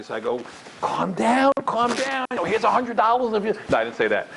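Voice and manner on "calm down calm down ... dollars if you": high-pitched, mock-frantic calming; panicked soothing. Speaker trying to stop a girl crying, mock-panicked high pleading. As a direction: plead for calm in a comic panic. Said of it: "I gotta get her to stop crying".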